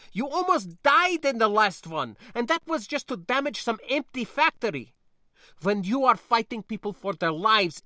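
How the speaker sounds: put-on high, loud accented niece, lecturing; fuming niece. Niece warning spacer about real battles, fuming. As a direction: scold with urgent concern in a foreign accent.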